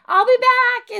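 high, loud holler to a neighbor; cheery call. Acted. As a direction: shout it out in passing. Calls to waving neighbor.

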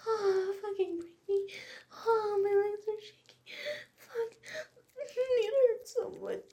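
high, loud cries of pleasure; loud moaning cries. Acted. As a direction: let it burst out in loud, high moans. Intense sex scene, crying out.

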